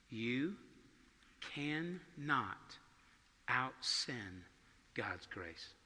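low, hushed, word by word; earnest mentor's counsel. Retold or imitated. Preacher quotes his mentor across the table, quiet and grave. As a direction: let each word sink in, softly, with long pauses. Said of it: "looked me in the eyes and said this".

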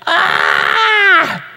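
high-pitched mock wail of misery; exasperated wail. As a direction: cry out in hammed-up frustration. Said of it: "I'm just like".

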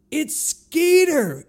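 high, loud, slow yelled correction; scream from the crowd. Retold or imitated. Woman near the front correcting the band name, loud slow yell. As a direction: yell it across the arena. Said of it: "screams out".

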